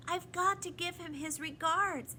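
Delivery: high little plaintive voice; tearful. A narrator's reading. Lindy begs to deliver the regards, tearful. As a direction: plead as if near tears. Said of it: "added Lindy tearfully".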